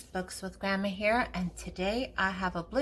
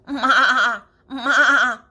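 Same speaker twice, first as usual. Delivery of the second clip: loud bleating ma with a laugh; goat bleat; 4 semitones higher, 12 dB louder than usual. Acted. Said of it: "the name for a baby goat".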